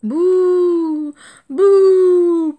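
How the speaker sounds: put-on high, loud baby elephant boo-hoo; loud boo-hoo crying; loud wailing sob. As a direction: bawl it out like a crying child. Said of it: "pleure-t-il".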